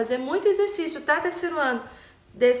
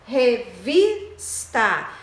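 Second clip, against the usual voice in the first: syllable by syllable "Revista", strong on VIS; stressed syllable; much slower than usual. Shown as a demonstration. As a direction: hit the middle syllable of "Revista" hard. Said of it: "o vis é a penúltima".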